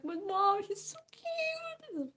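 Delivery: very high, drawn-out squeal; mock-whiny cuteness overload; pleading kid. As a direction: plead in a squeaky child voice, stretching the end. Voicing self as pleading kid.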